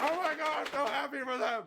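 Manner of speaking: excited squeal. Real, not acted. Squealing happiness.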